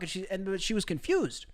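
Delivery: ordinary talk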